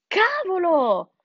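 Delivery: high, drawn-out cry of dismay; fox's yell. A narrator's reading. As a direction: yell out in shock. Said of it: "urlò Gustavo". (urlo allungato della volpe)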